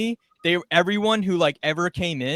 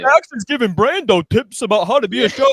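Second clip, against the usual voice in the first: mocking coworker; 5 semitones higher, 7 dB louder than usual.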